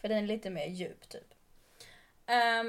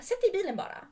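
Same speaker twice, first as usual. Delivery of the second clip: high, raised, insistent imitation; retold shouted order; 10 semitones higher, 5 dB louder, faster than usual. Stranger pressing her to take the lift, high raised order. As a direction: push the order out high and louder. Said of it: "för att du skriker på mig".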